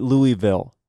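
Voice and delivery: Louisville with "ee" and no s: LOO-ee-vill. Shown as a demonstration. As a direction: say Louisville like Louie plus "ville". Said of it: "is it Louisville".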